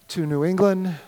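usual speaking voice

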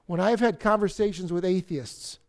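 usual speaking voice

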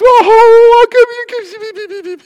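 high, slow, drawn-out anguished cry; panicked wail. Retold or imitated. Friend panics over someone's suffering.

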